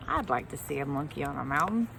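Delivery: low, quick silly monkey voice. Pretend. Teacher voices a monkey character.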